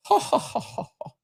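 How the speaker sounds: whispered, high mock laughing; breathy fake laugh. Reading out her written laugh.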